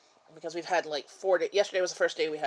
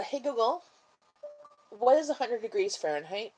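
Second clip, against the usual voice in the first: talking to voice assistant; 6 semitones higher than usual. Real, not acted. Asking a voice assistant.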